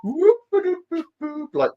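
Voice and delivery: rising whoop sound effects, high up; high whooping noises. Retold or imitated. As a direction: whoop like slapping paint on. Imitating brush strokes in art class.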